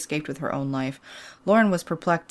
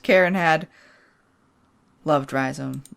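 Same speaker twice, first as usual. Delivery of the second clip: ordinary talk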